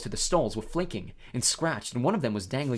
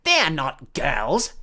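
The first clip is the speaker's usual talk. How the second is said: slow, drawn-out, louder objection; suspicious; 6 dB louder, much slower than usual. A narrator's reading. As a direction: stretch each word with suspicion. Myrtle suspiciously challenges the boys.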